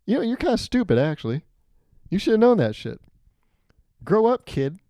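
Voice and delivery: high, mock scolding; hammed-up put-down; calling him out. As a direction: scold a kid as a joke. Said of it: "I start calling him out".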